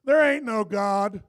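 loud and slow, each word spaced out; loud slow quote. Retold or imitated. Pastor quotes his unbelieving best friend, loud and slow. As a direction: throw out the friend's defiant words. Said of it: "here's his exact quote".

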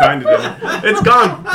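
laughing. Real, not acted. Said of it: "I don't have a voice anymore".

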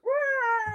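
very high-pitched mock screech; imitated creature screech. Imitating the gargoyle heard by the cab driver, high screech. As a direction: screech like a gargoyle, without extra volume. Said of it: "like, screech".

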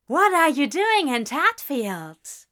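fake-thrilled old classmate; eager cry. A narrator's reading. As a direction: exclaim with false delight. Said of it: "she cried".

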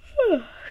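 long breathy yawn. Real, not acted. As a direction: yawn out loud. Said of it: "I say excuse me when I yawn".

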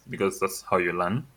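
ordinary delivery

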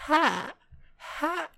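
high, soft open "ha" squeaks; high-pitched turtle mating cry. Retold or imitated. Failing at the singer's high voice, squeaky turtle imitation. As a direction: squeak out short falsetto moans like a mating turtle. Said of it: "this is my high pitch".

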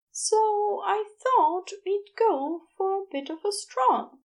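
put-on a gentle fussy angel explaining the baby; Aziraphale, fussing. A narrator's reading. Aziraphale voiced in story.

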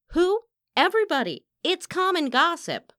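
high, loud, derisive answer; heated, mocking outburst; scornful retort. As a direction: throw the accusation back with scorn. Rival retorts in quarrel.

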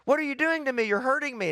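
retold anguished crying out. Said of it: "I was screaming and crying and saying".

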